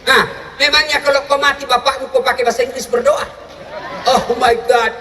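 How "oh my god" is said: half-laughing mock plea in English; mock English prayer. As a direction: ham up a desperate English cry. Mocking English prayer at father's death. (doa bahasa Inggris pura-pura, setengah tertawa)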